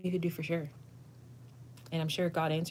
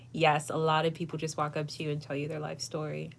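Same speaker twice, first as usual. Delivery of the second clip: ordinary delivery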